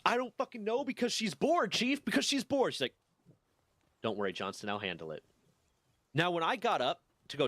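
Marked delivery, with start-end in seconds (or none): 0.0-2.7 high-pitched, fed-up whine; squeaky exasperated retelling to the chief; exasperated complaint. Retold or imitated. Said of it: "It's like". 4.0-5.2 reassuring chief. Retold or imitated. Chief calmly reassuring the complainer.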